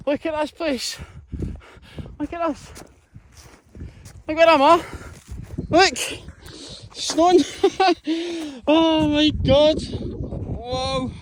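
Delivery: high, delighted exclaiming at falling snow; thrilled. Real, not acted. Hiker to viewers, marvelling at snow, thrilled and high. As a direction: gush over the first snow, voice way up.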